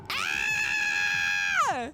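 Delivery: very high short shout; a real startled yelp; high scream. Real, not acted.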